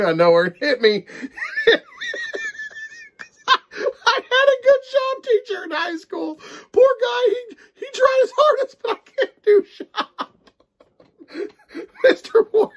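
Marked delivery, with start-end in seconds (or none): 1.1-1.8 helpless high-pitched giggle fit; high squealing laugh. Real, not acted. Reviewer cracking up on camera, high squealing laugh. 3.5-12.7 high, tearful laughing talk; laughing through words. Real, not acted. Reviewer remembering his shop teacher, laughing helplessly. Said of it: "I can't keep a straight face".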